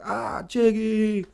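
high, slow drawn-out greeting; crowd cheering him. Retold or imitated. Bar regulars greeting their favorite bartender, high and drawn out. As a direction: stretch it out high like a fan greeting a star. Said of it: "everyone's like".

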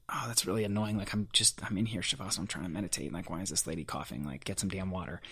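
petty annoyed thought, half-muttered; inner grumble. Retold or imitated. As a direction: grumble it under your breath. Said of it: "in my head, I was like".

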